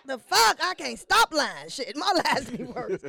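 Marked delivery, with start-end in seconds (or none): none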